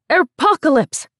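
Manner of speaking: high, loud mock scream; screaming headline. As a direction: shout it like a tabloid headline. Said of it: "screamed a Western newspaper".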